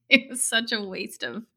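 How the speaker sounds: talking through a real laugh. Real, not acted. Host admitting last year's wasted effort, laughing. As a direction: laugh while owning up to it.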